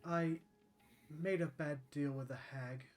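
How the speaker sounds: halting, subdued confession; lightly voiced sorrowful centaur, pausing often; regretful centaur lady. Centaur lady tells her sad tale.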